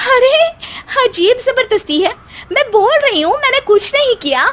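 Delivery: high, shrill-pitched denial; daughter-in-law denies blame in a high indignant voice; indignant protest. Acted.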